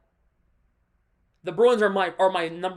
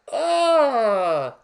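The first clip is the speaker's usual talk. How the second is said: frustrated groan; 4 semitones higher, 6 dB louder than usual. Real, not acted.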